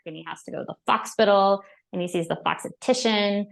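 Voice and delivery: normal talking voice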